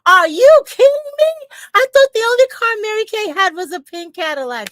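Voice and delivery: very high, loud disbelief; amazed customer. Retold or imitated. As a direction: shriek in astonished disbelief. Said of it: "She said".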